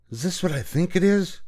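high squeak of fake surprise; squeaky. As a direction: squeak it out, voice cracking up. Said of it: "he squeaked".

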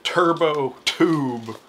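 slow dramatic product name; announcer voice. Acted. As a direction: announce it like a trailer.

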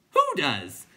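high, loud clown question; clown creature voice. A narrator's reading. Clown asking the others why, high and loud. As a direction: ask it in a silly high voice.

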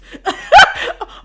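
high, loud burst of laughter. Real, not acted. Storyteller amused by her own bluff, loud high laugh.

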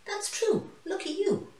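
high and bright with a grin; grinning lioness. A narrator's reading. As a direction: beam at her and lift the voice way up. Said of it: "Lauren grinned and said".

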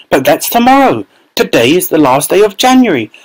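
a hint of a fretful mother; lightly voiced anxious mother. A narrator's reading. Mother realising the visit is tomorrow, fretting. Mrs Bucket voiced in story.

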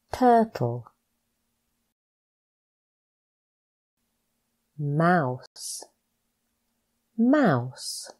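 each word spoken slowly and clearly, with a falling end; a teacher's slow, clear word-by-word drill; careful pronunciation drill. Shown as a demonstration. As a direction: model every word distinctly for the learner to repeat. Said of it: "Practice these words with me to pronounce the words properly".

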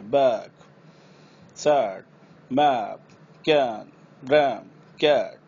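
कैन, रैम slow with a long ae; stretched ae. Shown as a demonstration. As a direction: drag each ae vowel slowly. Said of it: "long लेके जाना है". (लंबा ऐ)

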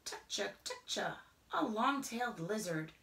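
plain reading voice

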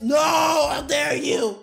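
outraged. Acted. Outraged at being offered a syringe.

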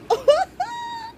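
a high squeaky laugh near tears; overjoyed squeal of a laugh; high teary laugh. Real, not acted. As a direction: laugh through happy tears. Overjoyed at the baby doll.

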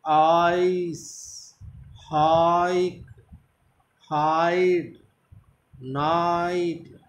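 हाइक with a long open aa, stretched out; आइस said slowly, leaning on the aa; stress on the aa. Shown as a demonstration. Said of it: "तो stress कहाँ पर रहेगा? आ पर।".

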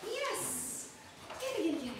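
high, eager get it; excited dog cue. Real, not acted. Trainer sending puppy after the ball, excited. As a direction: hype the puppy up. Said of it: "keep your voice excited".

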